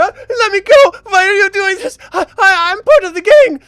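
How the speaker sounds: high-pitched, loud panicked cry; terrified pleading. Acted. Hostage with dagger to his throat begs captor, terrified. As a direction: stammer and beg for your life.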